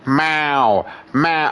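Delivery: long, falling yowled meow; nagging cat, each meow drawn out; imitating a cat's meow. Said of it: "you open the door".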